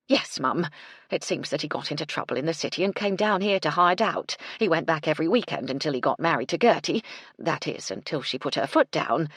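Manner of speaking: breathless, eager housekeeper, quickening at the end; a bustling cook hurrying up to her employer; Mrs Chubb voice. Acted. Mrs Chubb telling the story.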